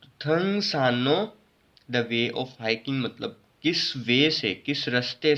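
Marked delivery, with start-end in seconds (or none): 0.1-1.4 थंगसानो slowly, with a held N; R becomes N. Shown as a demonstration. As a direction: draw out the न in थंगसानो. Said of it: "basically थंगसानो पढ़ेंगे". (थंगसानो, र की जगह लंबा न)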